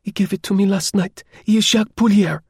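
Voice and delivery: put-on high-pitched, accented young woman; female character voice. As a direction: explain eagerly in a high voice. Voicing Clotilde explaining to officer.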